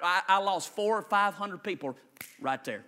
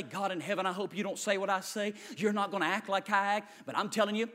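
ordinary delivery

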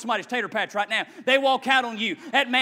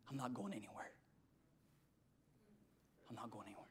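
whisper; 11 semitones lower, 28 dB quieter, much faster than usual. Acted. Whispered hidden meaning.

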